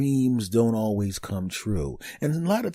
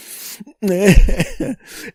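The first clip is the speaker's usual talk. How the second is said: big high burst of laughter; loud laugh; 10 semitones higher, 10 dB louder than usual. Real, not acted. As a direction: crack up at your own joke. Laughing at own joke.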